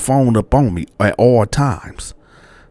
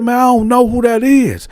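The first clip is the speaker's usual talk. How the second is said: retold frantic denial; heated denial; 14 semitones higher than usual. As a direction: deny it hotly. Denying it again.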